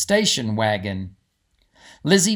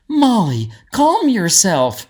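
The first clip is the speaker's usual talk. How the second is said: a touch of a higher, louder scolding voice; lightly voiced Gertie; 5 semitones higher, 6 dB louder, slower than usual. Gertie telling excited Molly to settle down. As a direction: rein her in, firm but kind, slowly. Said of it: "said Gertie".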